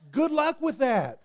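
high, slow, mocking "good luck"; sarcastic. Real, not acted. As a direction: tease them, drawn out and high. Preacher jokes with congregation.

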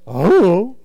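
"Oh" hummed loudly as a wordless I-don't-know; mumbled teen shrug. Retold or imitated. Kid dodging a scolding parent, "Oh" as a loud mumbled hum. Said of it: "It sounds like this".

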